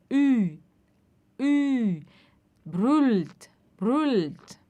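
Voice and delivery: "Ü" with pursed lips while saying i; rounded "Ü". Shown as a demonstration. Teacher drilling the umlaut in "brüllt". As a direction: pout and say i for "brüllt". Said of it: "faz um biquinho e fala I".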